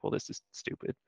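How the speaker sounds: muttered under breath; scoffing, near-whispered; exasperated aside. Retold or imitated. Quoted reaction to a bad card.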